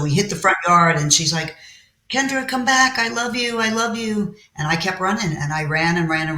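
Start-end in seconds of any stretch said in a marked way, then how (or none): 2.1-4.4 high, pleading imitation of mother; mother's put-on affection. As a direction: act out a sudden show of love, pitched high. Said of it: "her whole mood changed".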